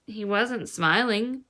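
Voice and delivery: plain reading voice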